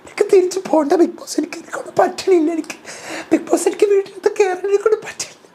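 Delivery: very high, laughing mock wail; hammed-up whiny Jasmine. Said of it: "Jasmine ഇതേ സമയം confession റൂമിൽ".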